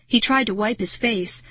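ordinary delivery

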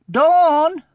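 high, loud call across the playground. A narrator's reading. Sophie calling Dawn over in the playground, loud high call. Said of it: "she called".

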